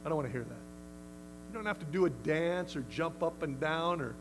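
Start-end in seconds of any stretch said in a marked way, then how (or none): 0.0-0.6 soft, low, firm rebuke; softly and firmly say be quiet; quiet dismissal. Shown as a demonstration. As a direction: calmly shut the whisper down. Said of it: "you just say".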